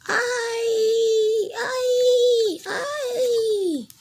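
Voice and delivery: high, loud panicked screams; screaming crayons. Acted. Crayons screaming in fright as the lights go out.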